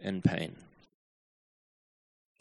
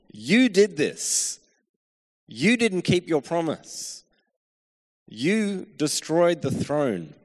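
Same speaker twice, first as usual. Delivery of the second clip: accusatory; 2 semitones higher than usual. Retold or imitated. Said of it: "these questions are pretty accusatory".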